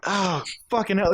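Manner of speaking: ordinary talk, nothing marked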